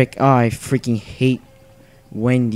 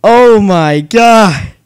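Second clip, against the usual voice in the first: real gamer's ecstatic shouting, high and loud; loud overjoyed outburst; 7 semitones higher, 7 dB louder, much slower than usual. Real, not acted. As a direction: shout yes in high, breathless disbelief. Wild celebration of game play.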